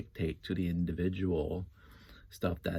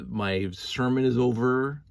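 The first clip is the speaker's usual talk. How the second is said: mock grand closing; 6 semitones higher, 6 dB louder, slower than usual. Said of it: "what I was ranting about".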